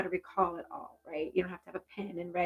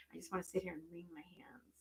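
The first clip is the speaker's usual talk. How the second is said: whisper; 14 dB quieter than usual. Real, not acted. Medium feels spirit's nervous habit.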